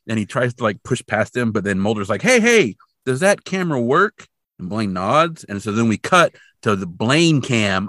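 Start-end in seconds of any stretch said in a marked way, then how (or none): 2.2-4.3 acted calling out. Mulder interrupts Blaine pushing past.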